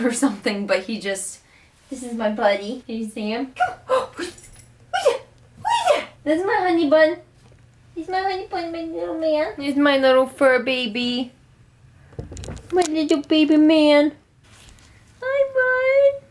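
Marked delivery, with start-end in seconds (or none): 3.6-4.3 high excited gasp at the dog; delighted gasp. Real, not acted. Owner spotting her dog on the bed, excited gasp. 6.2-16.2 high, cooing voice for a pet. Real, not acted. Mom-to-be cooing baby talk at her dog.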